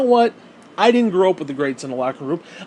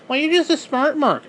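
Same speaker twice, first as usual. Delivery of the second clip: mock critic; 9 semitones higher than usual. Mocking an imagined critic.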